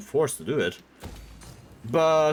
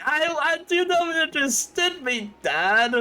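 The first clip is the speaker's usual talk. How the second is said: much higher squeaky whine; mock whiny son; 13 semitones higher than usual. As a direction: play a giant man whimpering. Giant son whining at dad.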